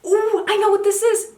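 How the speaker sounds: high, loud delighted "Ooh"; excited recognition. Real, not acted. Spotting a palette she owns, excitedly. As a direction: squeal it with a rush of recognition.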